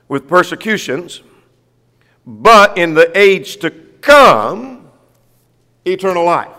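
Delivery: emphatic preacher reading from scripture. Acted. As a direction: declaim the verse to the congregation. Reads scripture aloud to congregation.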